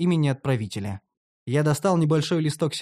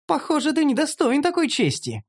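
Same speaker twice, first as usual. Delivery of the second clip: very high gloating female voice; high, gleeful gibe; mocking elf; 11 semitones higher than usual. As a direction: taunt him in a raised pitch. Elf mocks him after laughing.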